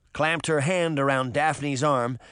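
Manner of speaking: usual speaking voice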